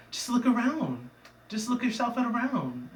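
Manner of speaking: high, swooping character voice; voiced village leader. Village leader persuading the newcomer.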